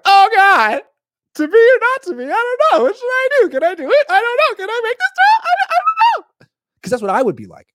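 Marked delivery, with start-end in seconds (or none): 0.0-6.5 very high, loud, wailing dithering; anguished Hamlet. Retold or imitated. Mocking a quarterback as anguished Hamlet, loud and high. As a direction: whine every doubt like a tortured prince. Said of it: "you can't be freaking Hamlet being like".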